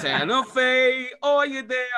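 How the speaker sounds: loud, high, drawn-out fake New York accent; bad New York accent. Mocking their own turn as Jack in Newsies, bad New York accent. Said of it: "the worst New York accent".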